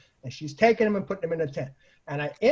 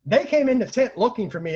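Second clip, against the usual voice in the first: normal talking voice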